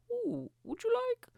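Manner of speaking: very high, hammed-up squeak; silly accent attempt. Imagined Primark rep knocking on his door, silly high voice. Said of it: "not gonna do that stupid accent".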